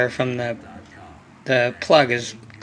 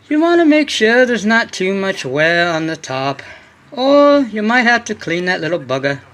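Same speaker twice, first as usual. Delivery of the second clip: put-on higher, louder stab at a British or Scottish accent; mock British accent; 6 semitones higher, 4 dB louder than usual. Quoting the British tuner's advice on pistons, in a mock British accent. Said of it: "frickin' cool British accent too".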